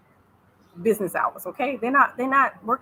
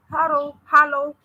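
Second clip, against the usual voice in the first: slow, higher Tanzanian-accented "hello"; 7 semitones higher, 6 dB louder than usual. Shown as a demonstration. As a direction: draw out "hello" as locals say it. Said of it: "speak the words like they do".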